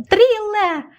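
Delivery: very high, drawn-out call of the name; high calling shout; urgent cry. A narrator's reading. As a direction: call the name desperately, pitch leaping up. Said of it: "знов крикнула Лена".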